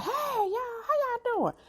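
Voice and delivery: high, bright-voiced mom greeting; imitating mom. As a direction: mimic mom sitting up cheerful. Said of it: "my mother was real, like, outgoing, soft-spoken voice".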